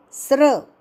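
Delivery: स्र said as one cluster, s with half r; cluster sra. Shown as a demonstration. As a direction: isolate स्र crisply. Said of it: "स के पेट में आधा र".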